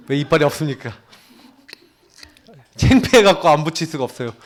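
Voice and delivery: ordinary talk, nothing marked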